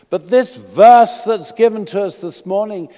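fervent sermon climax, loud and high; preaching at full force. Acted. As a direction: preach it out to the whole hall.

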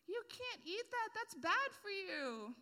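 lightly voiced little kid, high and scolding; a hint of a kid nagging about your ice cream. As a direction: play a bossy little kid scolding you. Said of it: "and he goes".

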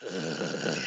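low, soft mock grunt; imitated commentator groan. Commentator heard while fan defends himself, low groaning. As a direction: let out a long lecherous "ugh". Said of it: "all you hear be like".

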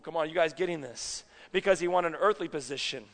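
ordinary delivery

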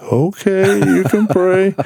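pitched well up, meek mock voice; put-on high voice of a reluctant man; hesitant guy. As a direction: play the guy giving in, squeaky and unsure. Guy agreeing to be prayed for.

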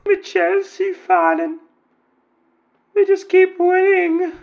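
angry football fan in a high mock female voice; falsetto woman caller. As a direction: squeak it out like a fed-up lady fan.

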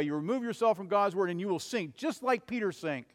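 ordinary delivery

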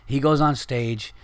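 ordinary talk

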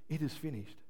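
soft, drained, giving up; weak defeated sigh. Retold or imitated. Preacher mocking a defeated Jesus, soft and drained. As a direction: let it fall out of a beaten man. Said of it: "It's not how it was".

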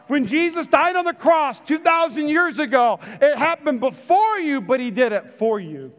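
loud, high, fervent preaching. Acted. Pastor preaching hard to his church, fervent and loud.

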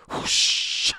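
unvoiced rushing train whoosh; mock train whoosh. Faking a high-speed train to get back to New York, unvoiced whoosh. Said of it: "I didn't bring the sound effects".

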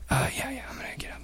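groggy whisper; sleepy guest. Retold or imitated. Guest woken at three answering in a groggy whisper. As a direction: mumble half asleep.

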